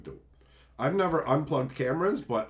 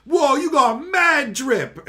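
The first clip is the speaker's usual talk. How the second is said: put-on high, slow, loud dopey kid voice; a hammed-up punk kid at the mall; mocking punk kid; 10 semitones higher, 7 dB louder, much slower than usual. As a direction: drag it out like a dumb teenager.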